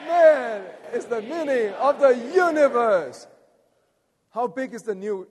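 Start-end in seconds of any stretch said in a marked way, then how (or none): 0.1-3.3 man hit loud and high, then held; stress on man. Shown as a demonstration. Preacher leading congregation in one more reading, man emphasized. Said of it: "How about we emphasize the word man".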